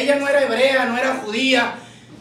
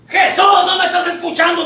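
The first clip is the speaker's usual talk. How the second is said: loud, higher cry for attention; pleading call; 4 semitones higher, 3 dB louder than usual. Acted. Ignored mother calling after Jesus, pleading call.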